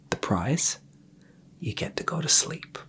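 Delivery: ASMR whisper. Acted. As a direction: whisper them gently off to sleep.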